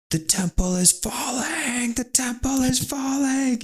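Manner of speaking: high, drawn-out mock scream; screaming old man. Dying Zen master warning the monks, high imitated scream. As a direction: cry it out high and stretched, in imitation. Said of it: "looks around and he just goes".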